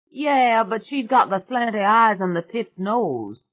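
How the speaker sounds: put-on loud, high, brash country girl; a loud, high swamp witch bragging to the family. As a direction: belt it out like a cocky backwoods girl. [name]'s rowdy swamp girl voice.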